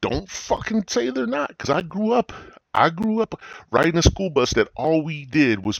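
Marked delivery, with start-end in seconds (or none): none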